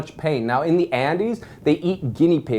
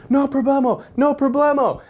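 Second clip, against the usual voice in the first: high, frantic no problemo; talking down an angry mob, high and nervous; nervous reassurance; 11 semitones higher, 3 dB louder than usual. Retold or imitated. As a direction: placate them in a panic, pitched up. Panicked reassurance to chasing mob.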